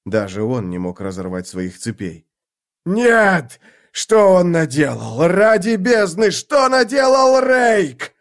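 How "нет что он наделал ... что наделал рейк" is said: horrified shout. Acted. Draconus shouting in horror.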